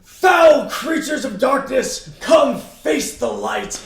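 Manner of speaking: loud, high, drawn-out mock-paladin call; slow heroic proclamation. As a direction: declaim it slowly like a zealous knight. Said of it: "He's like".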